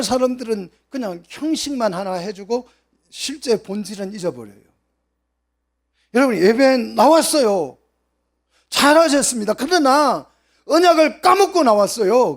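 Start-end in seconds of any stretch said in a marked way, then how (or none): 6.1-12.4 higher and much louder, driving the rebuke; preaching at full force. Acted. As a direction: call out the congregation with force. Rebuking worshippers. (힘찬 설교조로 꾸짖듯)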